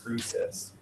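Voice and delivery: attempted Canadian pronunciation; Canadian accent. Shown as a demonstration. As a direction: try the long Canadian O, quietly. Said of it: "I can't say it right".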